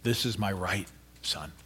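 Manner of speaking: very soft, low, fading to a whisper. Acted. Mother whispering to her son, very soft. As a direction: whisper it tenderly to a hurt son. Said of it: "she whispered softly".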